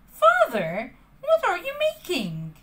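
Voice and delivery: put-on high, eager boy's question; curious puppet. Pinocchio asking father at workbench, high curious voice. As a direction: ask like a curious little boy. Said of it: "Pinocchio asked".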